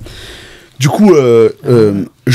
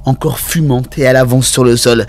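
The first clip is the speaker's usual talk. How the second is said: usual speaking voice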